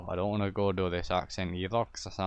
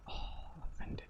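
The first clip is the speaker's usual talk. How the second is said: whispered, much softer, mock hurt; mock offended whisper; 11 dB quieter, much faster than usual. Told no about the petrol station, offended whisper. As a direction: breathe it out as a fake wounded whisper. Reacting to refusal.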